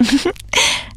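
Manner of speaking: light unvoiced giggle, rising; a quick embarrassed little laugh; breathy laugh. A narrator's reading. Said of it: "روزا خندید".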